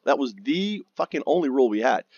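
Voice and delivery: ordinary talk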